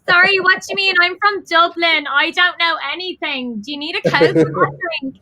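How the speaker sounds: baffled Dubliner. Retold or imitated. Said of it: "I was like".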